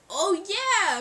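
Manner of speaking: high, loud drawn-out exclamation; mock exclamation. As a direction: imitate a friend's big reaction. Imitates friend's exclaimed reply.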